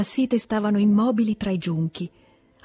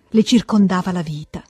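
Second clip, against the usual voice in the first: ordinary delivery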